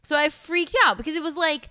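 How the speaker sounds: high, fast panicked retelling; freaking out. Real, not acted. Parent retelling the candy discovery, panicked and high. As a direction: voice flies up in alarm. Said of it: "Like, freaking out".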